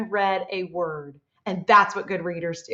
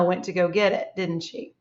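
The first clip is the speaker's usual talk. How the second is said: normal talking voice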